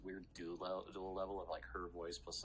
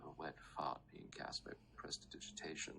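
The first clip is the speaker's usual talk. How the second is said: menacing whisper; 3 semitones lower than usual. Acted. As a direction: purr it with threat.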